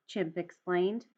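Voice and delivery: ordinary delivery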